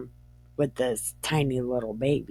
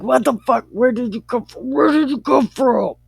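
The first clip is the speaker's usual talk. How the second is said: high, loud, rushed mock panic; frantic retold outburst; super freaked out; 9 semitones higher, 6 dB louder, faster than usual. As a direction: play it startled and panicky, pitched way up. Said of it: "super freaked out".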